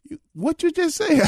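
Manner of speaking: voicing a stunned young man; shocked disbelief. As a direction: recoil in disbelief.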